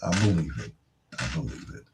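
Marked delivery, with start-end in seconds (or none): 1.2-1.8 breathy whisper, repeating it to himself; whispered echo. Real, not acted. DJ whispering his own agreement back to listeners. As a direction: hush it as a private aside.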